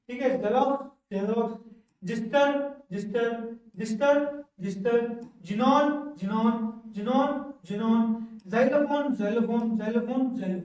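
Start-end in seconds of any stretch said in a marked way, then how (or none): 5.5-8.0 xenon stretched out very slowly, syllable by syllable; slow drill. Shown as a demonstration. Teacher drilling students on xenon, very slowly. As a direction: draw out xenon slowly so students can copy it. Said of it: "teacher drills the word xenon".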